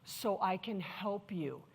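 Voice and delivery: soft, slow offer of help; gentle reassurance. Retold or imitated. Manager to an upset employee, gently reassuring. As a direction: offer help softly and unhurriedly.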